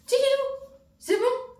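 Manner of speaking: silly high-pitched singsong; squeaky high voice. Acted. Playful singsong exclamation.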